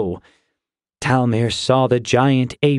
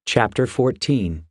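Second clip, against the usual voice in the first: usual speaking voice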